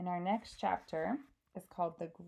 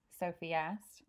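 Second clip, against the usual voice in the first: usual speaking voice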